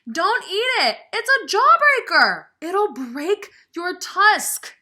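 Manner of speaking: high, loud, frantic squeak; panicked mouse squeak. Acted. Mister Mouse begging Ganesha not to eat it, frantic squeak. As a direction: squeal the warning in alarm. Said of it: "squeaked Mister Mouse".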